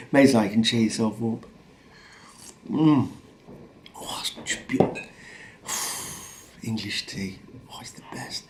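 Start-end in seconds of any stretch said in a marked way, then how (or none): 2.7-8.4 hushed savouring between sighs; blissful whisper. Real, not acted. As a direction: whisper your love of the tea. Savouring a cup of tea.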